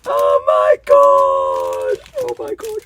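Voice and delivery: long, loud, high cry of delight; ecstatic outburst. Real, not acted. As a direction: draw it out slowly at full volume. Thrilled by the packaging.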